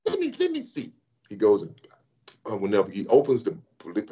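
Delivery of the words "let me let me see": much higher nasal mimic; John Shaw's voice. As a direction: play the GM checking the stats, nasal. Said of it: "he has a nasally voice".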